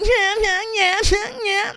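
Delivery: high, breathless yeah yeah yeah; mock orgasm moan. Comic mocking sex moans, high and crying. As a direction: fake a climax, voice cracking high.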